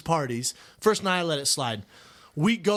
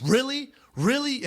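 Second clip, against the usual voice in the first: higher, mock incredulous repeat; hammed-up disbelief from the husband; 8 semitones higher than usual. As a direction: imitate a husband who can't believe it. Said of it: "her husband goes".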